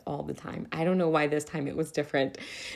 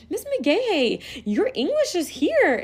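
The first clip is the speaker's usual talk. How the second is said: put-on teasing child; 11 semitones higher, 4 dB louder, slower than usual. Student teasing teacher, retold.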